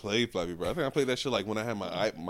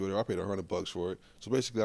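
ordinary talk

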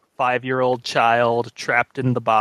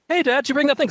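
mock son voice; 12 semitones higher, much faster than usual.

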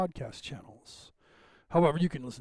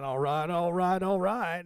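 Matthew McConaughey; 3 semitones higher than usual. Retold or imitated. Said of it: "like Matthew McConaughey".

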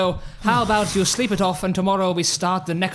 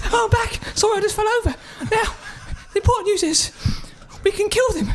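squeaky voice; 14 semitones higher, much faster than usual. Acted. Schmeichel squeaky voice.

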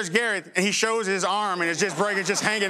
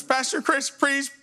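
high, slow, whimpering plea; pleading injured boy; 5 semitones higher, slower than usual. Retold or imitated. Injured boy begging his youth pastor to pray, high slow whimper. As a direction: beg like a hurt kid in pain.

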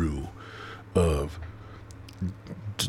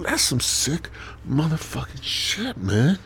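ordinary talk, nothing marked

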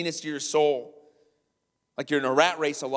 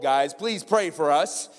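usual speaking voice

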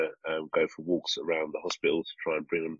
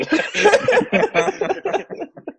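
loud, high burst of laughter; big laugh; 7 dB louder than usual. Real, not acted. After joking with friends about the ward, loud high laugh. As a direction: crack up at your own punchline.

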